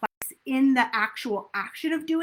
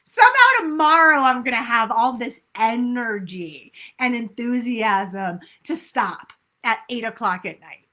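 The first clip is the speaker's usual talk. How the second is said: mock wishful thinking; 8 dB louder than usual. Coach mocks the tomorrow excuse, loud and hammed-up. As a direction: ham up the tomorrow-will-be-better excuse. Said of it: "We say".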